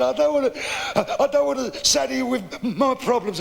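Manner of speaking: high, halting mock sobs; crying old man. Old man reluctant to burden a stranger, mock tearful. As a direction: act a tearful old man, stumbling over his words. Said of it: "crying his eyes out".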